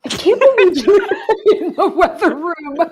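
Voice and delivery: high-pitched words through helpless laughter; real laughing. Real, not acted. Host cracking up at co-host's weather room handoff, real laughing. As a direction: crack up while saying it.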